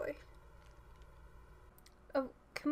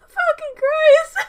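loud, high, stretched tearful curse; real sobbing; 19 dB louder, slower than usual. Real, not acted. Viewer curses at the sad scene, sobbing loudly. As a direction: curse through heaving sobs.

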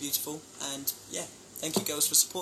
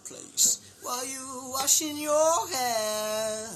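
mock quote; 5 semitones higher, 4 dB louder, much slower than usual. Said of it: "You said".

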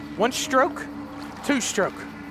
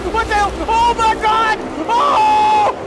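real startled shrieks, much higher and louder; startled screaming; 8 semitones higher, 6 dB louder, much slower than usual. Real, not acted. Gamer jolted by a sudden monster, screaming. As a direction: yelp and scream at the jump scare. Jump scare in game.

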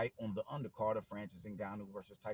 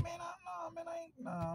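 mock reluctance; 5 dB quieter than usual. Fight fan passing on the undercard, high-pitched reluctance. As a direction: wave it off in a much higher voice. Acted reluctant viewer turning it down.

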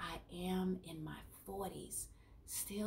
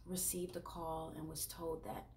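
soft, halting, sinking to a whisper; subdued grief; 8 dB quieter than usual. Real, not acted. Therapist telling of losing a little patient, subdued and grieving. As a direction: break the bad news quietly, with pauses.

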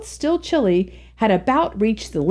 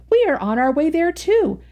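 put-on higher, lively girlish voice; young woman's voice; 8 semitones higher than usual. A narrator's reading. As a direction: chatter like an eager schoolgirl friend.

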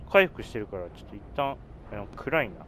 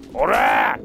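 battle cry; 3 semitones lower, 5 dB louder, much slower than usual. Acted.

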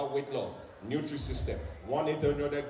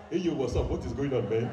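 put-on full African accent on the greeting; proud African accent; 3 semitones lower than usual. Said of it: "I am now proud of my African accent".

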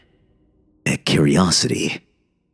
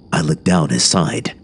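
plain reading voice

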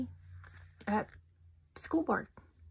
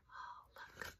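whisper; 21 dB quieter than usual. Acted. Whispered aside.

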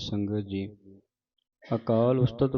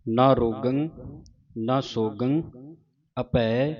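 1 recitation; 5 dB louder, much slower than usual. Shown as a demonstration. Chanted recitation.

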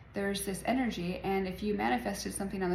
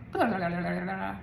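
mock head chatter. Vlogger mocking her own busy mind, babbling blah blah. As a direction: play the noise in a busy head. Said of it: "So my head is just like".

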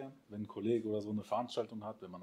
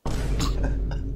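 short laugh; 8 dB louder than usual. Real, not acted. Laughs at beer question.

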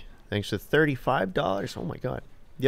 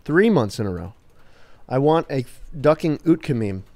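ordinary delivery

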